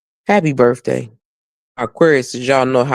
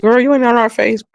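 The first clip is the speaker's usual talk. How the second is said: sassy comeback; 11 semitones higher, 4 dB louder than usual. Retold or imitated. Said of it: "I probably be like".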